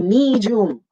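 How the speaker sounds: "Medium" with long ee and a j, mee-joom; Filipino-style "Medium". Shown as a demonstration. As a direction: say "Medium" in two syllables with j. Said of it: "and the long E".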